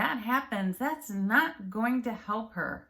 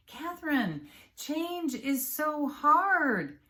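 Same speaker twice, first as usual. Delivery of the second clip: high, slow, whining protest; drawn-out high complaint; whiny complaint; 9 semitones higher, 4 dB louder, slower than usual. Pretend. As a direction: moan that change is too hard like a discouraged client. Imagined client objecting.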